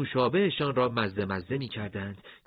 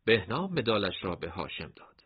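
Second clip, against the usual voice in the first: ordinary delivery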